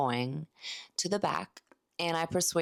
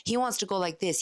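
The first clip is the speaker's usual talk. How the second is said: usual speaking voice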